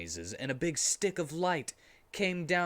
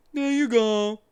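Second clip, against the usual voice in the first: loud, higher cheerful voice; smiling lunch lady; 8 semitones higher, 9 dB louder than usual. A narrator's reading. Lunch lady handing over the coffin, loud and beaming. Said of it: "with a face full of smiles".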